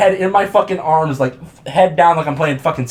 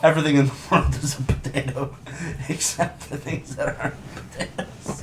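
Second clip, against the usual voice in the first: laughing; 5 semitones lower, 8 dB quieter than usual. Real, not acted. Cracking up at own potato joke.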